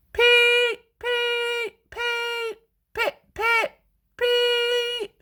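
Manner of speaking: high shrill whistle sounds; Henry whistling before the tunnel; engine whistle. A narrator's reading. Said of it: "he whistled".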